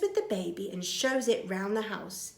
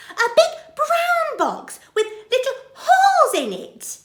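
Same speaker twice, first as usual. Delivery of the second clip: very high, loud, half laughing half crying; tearful astonishment; 11 dB louder than usual. Acted. Betty realises the baby is hers, overwhelmed. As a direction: near tears with joy. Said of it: "says Burglar Betty".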